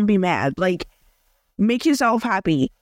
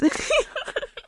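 short airy laugh at herself; high giggle; 5 dB quieter than usual. Real, not acted. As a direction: laugh off the awkward pause. Laugh after noting long pause.

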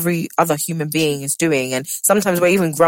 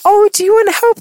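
excited offer; 5 dB louder than usual. Retold or imitated. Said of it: "she's like".

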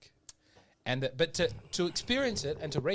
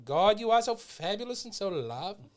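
higher-pitched mock liturgy; imitated gushing prayer; 7 semitones higher, 4 dB louder than usual. Imitating worship at an Anglican ordinariate, mock gushing prayer. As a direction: gush in praise, sending it up. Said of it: "when you say it like that".

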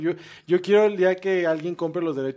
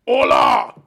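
gruff shouted monster voice; Cookie Monster voice; 7 semitones higher, 8 dB louder, much slower than usual. Pretend. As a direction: growl it like a cookie-loving monster. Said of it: "El monstruo come galletas qué opina".

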